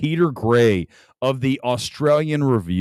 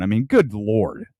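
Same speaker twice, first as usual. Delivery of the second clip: ordinary delivery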